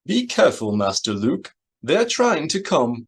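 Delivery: high, agitated droid voice; high-pitched, urgent warning in a droid's voice; C-3PO warning. A narrator's reading. Droid warns his master over the comlink, high and alarmed. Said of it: "C three P O's voice came over their comlinks".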